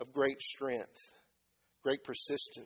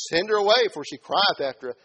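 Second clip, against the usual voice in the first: loud, raised quoting of a complaint; insistent demand; 5 semitones higher, 10 dB louder than usual. Acted. Disciples asking Jesus to dismiss woman, insistent. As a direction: call it out to get rid of her.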